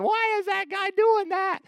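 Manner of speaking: put-on small boy baffled by the preacher; scared little boy. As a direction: squeak it like a spooked kid. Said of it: "freaking out, thinking".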